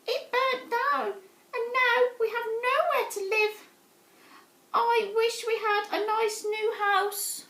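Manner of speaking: very high, squeaky, worried mouse voice; a tiny mouse mother pleading. Acted. Mother mouse telling George her house burnt, high squeaky voice. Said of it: "squeaked the mother mouse".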